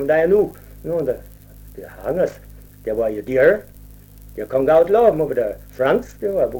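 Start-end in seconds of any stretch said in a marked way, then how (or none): none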